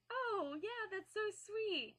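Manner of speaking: answering the stranger's marriage advice in a high polite gush; awkward sweet reply. Retold or imitated. Own awkward reply quoted.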